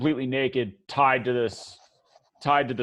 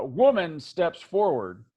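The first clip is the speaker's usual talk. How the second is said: ordinary delivery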